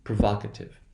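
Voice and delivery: "provocative" as pro-VAHK-ative; stressed short open vowel in "provocative"; short O, practice model. Shown as a demonstration. Teacher modelling practice for students, short O. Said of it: "that will be the short value".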